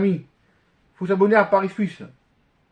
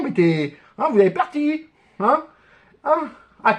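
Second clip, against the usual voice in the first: ordinary talk, nothing marked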